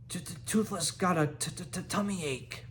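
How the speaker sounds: fast, stammering little dragon whine; small dragon faking a tummy ache; stuttering complaint. A narrator's reading. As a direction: whinge it, stumbling over the t's. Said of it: "he complained".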